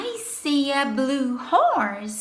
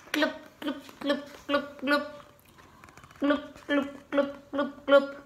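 quiet bubbly glub glub; soft blub like a fish; 2 semitones lower, 7 dB quieter, faster than usual. Pretend. Fish sound in picture book.

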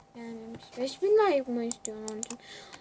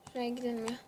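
normal talking voice

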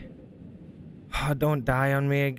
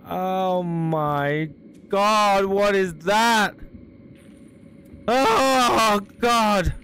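terrified; 4 semitones higher, 4 dB louder, much slower than usual. Real, not acted. Horror game jump scare.

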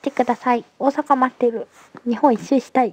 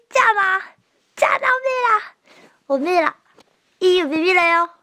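tough-guy farewell; 8 semitones higher, faster than usual. Acted. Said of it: "モノマネをして".

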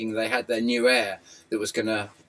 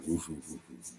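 imitated barking; 10 semitones lower, 13 dB quieter than usual. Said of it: "she's a bit like".

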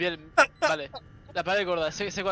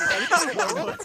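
pleading; 12 semitones higher, 4 dB louder than usual. Real, not acted. Begging teammate to shoot.

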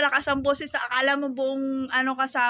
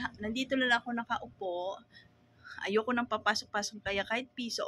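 normal talking voice

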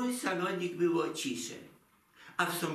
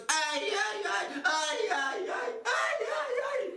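high "aj, aj" lament rising ever higher; comic wailing; 13 semitones higher than usual. Acted. Mother lamenting over the money, a high comic wail.

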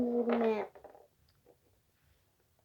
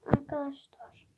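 whisper; 5 dB quieter than usual. Real, not acted. Host whispers to viewers.

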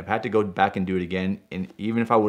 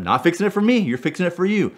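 higher, insistent angry comeback; heated retort; 9 semitones higher than usual. Retold or imitated. Owner correcting the dealership, higher heated retort. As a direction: correct them sharply. Arguing with dealership about car.

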